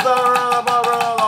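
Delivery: loud and high, pounding blah, blah; noisy drummer hammering away. Shown as a demonstration. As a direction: bang out each blah like heavy drumming. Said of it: "if I'm playing real loud".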